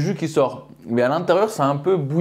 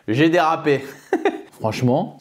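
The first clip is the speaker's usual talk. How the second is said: ordinary delivery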